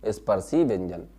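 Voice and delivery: usual speaking voice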